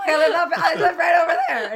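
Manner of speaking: flustered and giggling; laughing reply. Retold or imitated.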